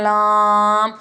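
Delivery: लाम said wrongly, pushed through the nose; wrong nasal lam. Shown as a demonstration. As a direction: imitate the common mistake, लाम nasalized. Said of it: "नाक में आवाज़ ले जाकर पढ़ते हैं". (लाम ग़लत तरीक़े से, नाक में आवाज़ ले जाकर)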